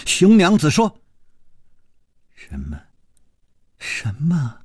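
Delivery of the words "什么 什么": stunned disbelief. Acted. Dying woman asks her rival in disbelief if she is jealous. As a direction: gasp out the questions in shock.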